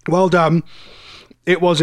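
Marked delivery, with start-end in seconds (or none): 0.1-0.6 mouth full. Real, not acted. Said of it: "I got my mouth full".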